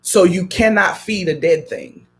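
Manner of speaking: usual speaking voice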